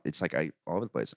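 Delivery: ordinary talk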